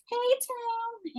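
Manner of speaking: high, sugary greeting; mock sweet mom. Mom greeting the boyfriend, high and sugary. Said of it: "She's like".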